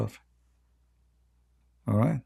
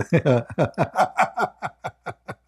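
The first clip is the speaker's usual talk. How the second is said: laughter climbing higher and higher; rising helpless laugh; 5 semitones higher than usual. Real, not acted. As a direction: laugh until you can barely stop. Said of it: "laughing so hard I can't even breathe".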